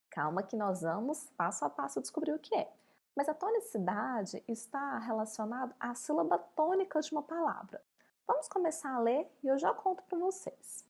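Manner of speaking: normal talking voice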